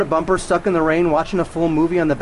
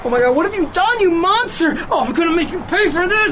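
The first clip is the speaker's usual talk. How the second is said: anguished rage; 12 semitones higher than usual. Acted. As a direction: scream it at the monster. Grief turning to rage.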